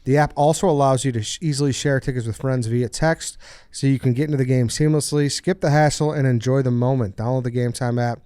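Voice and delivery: upbeat sponsor read. Acted. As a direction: read it like a podcast ad. Reads the sponsor ad.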